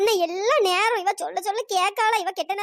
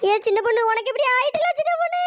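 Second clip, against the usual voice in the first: acted sobbing wail, rising in pitch; wailing lament; 3 dB louder than usual. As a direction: wail over an injured friend. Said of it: "ஒப்பாரி வச்சிட்டு இருக்கா".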